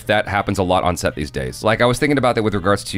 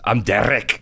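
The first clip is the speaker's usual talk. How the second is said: put-on Scottish burr on the name; mock-Scottish introduction; thick Scottish accent; slower than usual. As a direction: imitate a Scotsman giving his name. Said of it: "thick Scottish accent".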